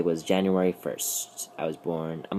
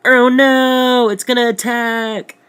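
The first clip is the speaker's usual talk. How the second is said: high shrieking panic, much louder; mock panic; 10 dB louder, slower than usual. Telling how he panicked at the monster, high shrieking mock panic. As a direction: squeal it like a scared kid. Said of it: "and I was like".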